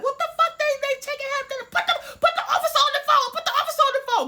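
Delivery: very high, loud, fast screaming demand; frantic yelling. Retold or imitated. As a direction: shriek at the cops through the phone. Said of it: "she starts going fucking crazy".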